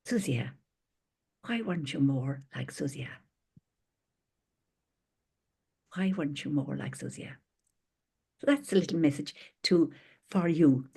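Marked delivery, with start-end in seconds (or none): 0.1-7.4 a hint of God asking gently; softly voiced divine question; mild voice of God. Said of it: "when God says to me".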